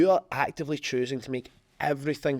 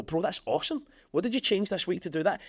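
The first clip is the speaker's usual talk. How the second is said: higher-pitched enthusiastic praise; impressed; 7 semitones higher than usual. Acted. Business owner congratulating a friend on results, impressed. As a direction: congratulate a mate with eager curiosity.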